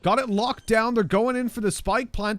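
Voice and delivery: high, loud hype call; esports caster. Acted. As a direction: ride the tension of the retake.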